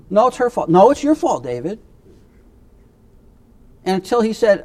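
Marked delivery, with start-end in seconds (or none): 0.1-1.8 put-on higher and louder, childish back-and-forth blame; mock bickering. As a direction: argue like two squabbling kids. Acted quarrel, blame shifting.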